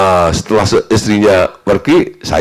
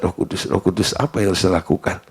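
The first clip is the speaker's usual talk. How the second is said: quiet prayer; 2 semitones lower, 8 dB quieter than usual. Retold or imitated. Silent prayer for wisdom.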